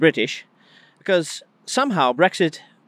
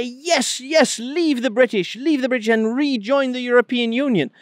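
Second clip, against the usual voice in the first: pitched way up, a mocking cheer from the bureaucrats; mock shout; 6 semitones higher than usual. Mocking EU bureaucrats urging the Scots to leave Britain, high mock yell. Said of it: "shout and yell and say".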